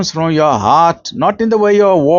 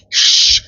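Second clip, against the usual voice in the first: a drawn-out hushing shh; shushing. Retold or imitated. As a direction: hush them like a librarian. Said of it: "Doesn't mean now you must say".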